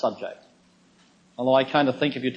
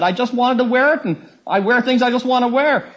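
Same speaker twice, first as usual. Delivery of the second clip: high, louder mock protest; insistent protest; 9 semitones higher, 5 dB louder than usual. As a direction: imitate someone digging in, pitched high. Retold exchange, woman insisting.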